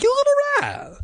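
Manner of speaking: very high mock shriek of outrage; outraged cry. A narrator's reading. Diane attacking the prankster, shrieking. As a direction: screech it at the top of the range. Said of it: "Diane cried".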